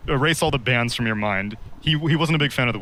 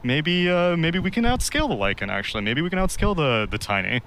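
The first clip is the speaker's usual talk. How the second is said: higher, dithering voice changing its mind mid-draft; mock wishy-washy drafter; 7 semitones higher than usual. Analyst mocking a team that abandons its draft plan, higher dithering voice. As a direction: lightly play an indecisive captain, second-guessing. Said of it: "you can't be like".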